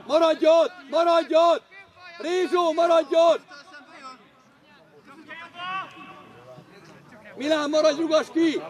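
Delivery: loud, insistent sideline commands; shouted orders. Real, not acted. Coach orders players to stay there, shouted orders. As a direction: yell so the players hold position.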